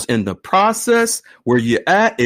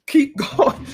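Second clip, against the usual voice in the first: laughing; 10 semitones higher than usual. Real, not acted. Laughing through raised hands count.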